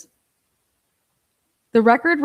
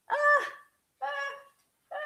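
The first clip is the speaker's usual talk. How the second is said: short squeaked "ah", spaced out; high stunned little "ah" noises; high-pitched "ah" of disbelief; 8 dB quieter, faster than usual. Real, not acted. Wordless disbelief at "good faith.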